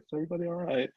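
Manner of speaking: high, polite checking-in; imitating a partner's tentative check; retold question, lifted in pitch; British politeness. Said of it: "kind of the British thing".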